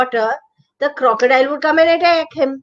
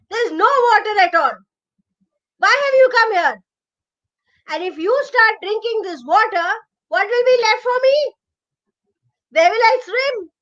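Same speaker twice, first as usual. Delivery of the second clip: angry crocodile; 7 semitones higher than usual. Acted. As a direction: bark him away from the river. Crocodile shouts at elephant.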